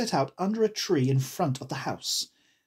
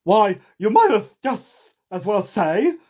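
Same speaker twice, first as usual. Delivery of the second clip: Hatter; 6 semitones higher, 8 dB louder than usual. A narrator's reading. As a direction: contradict forcefully in a put-on voice. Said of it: "said the Hatter".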